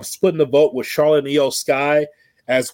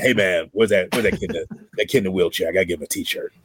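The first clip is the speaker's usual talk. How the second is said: repeating the imitation, much lower, trailing off; low mock Prince Nana voice; telling the co-hosts about Nana's accent; 8 semitones lower, faster than usual. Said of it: "He's like".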